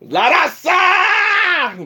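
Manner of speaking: rising, drawn-out hype shout; Chicano shout-out. Acted. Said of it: "for my Mexican people".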